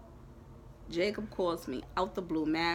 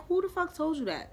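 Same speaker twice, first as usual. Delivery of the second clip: retold shock; 9 semitones higher than usual. Shocked reply to ex's news.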